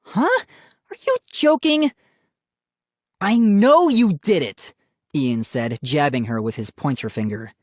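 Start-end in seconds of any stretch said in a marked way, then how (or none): 0.0-1.9 very high incredulous question; shocked. A narrator's reading. Sister stunned by brother's accusation, shocked. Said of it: "her eyes wide with shock". 3.2-4.7 loud, higher insistent accusation; accusing. A narrator's reading. Brother insisting sister did it, accusing.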